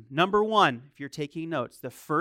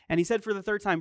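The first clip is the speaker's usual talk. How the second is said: ordinary talk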